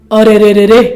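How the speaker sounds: loud, fed-up cry to stop her; exasperated outcry. Acted. Son cuts off shouting mother. (झुँझलाकर ज़ोर से टोकना)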